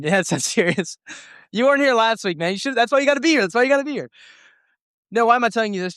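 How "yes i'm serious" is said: laughing through the words. Real, not acted. Pastor insisting to a student, laughing. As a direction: chuckle as you insist.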